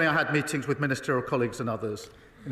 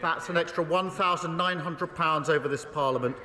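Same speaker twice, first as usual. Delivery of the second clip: high, forceful voice over the jeering chamber; raised debating voice; 6 semitones higher than usual. Real, not acted. Prime Minister attacks the opposition across a noisy chamber, raised and forceful. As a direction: project it hard to carry over the House.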